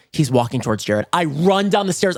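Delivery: ordinary delivery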